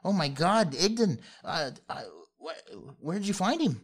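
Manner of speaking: put-on high, loud fretting old woman; a frantic granny begging for answers; panicked old lady. Old lady asking the travelers about the gnome, high and panicked.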